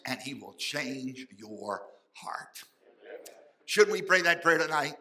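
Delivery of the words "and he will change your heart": slow breathy whisper; closing promise to the congregation, whispered. Real, not acted. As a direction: hush it, almost to yourself. Sermon closing, intimate promise.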